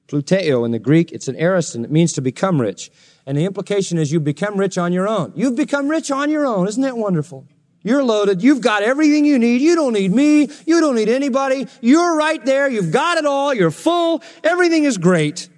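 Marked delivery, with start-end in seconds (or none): 5.3-15.5 high-pitched mock congratulation; sarcastic. Paul to the self-made rich Corinthians, sarcastic. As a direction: heap on fake praise, pitched up. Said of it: "Well, that's sarcastic".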